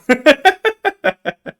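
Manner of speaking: high-pitched rapid laugh. Real, not acted. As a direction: burst into a high cackle. Bursts out laughing after movie quote.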